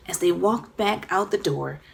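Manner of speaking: ordinary delivery, nothing marked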